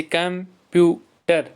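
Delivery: "Computer" slowly as कं, प्यू, टर; three separate beats on "computer"; syllable by syllable. Shown as a demonstration. Teacher counting three syllables for pupils, syllable by syllable. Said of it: "इसमें तीन syllables हैं".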